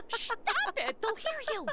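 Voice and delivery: hushed giggling. Acted. Madison hushes Alta so the crew won't hear, giggling. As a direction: shush her through giggles. Sneaking, afraid to be heard.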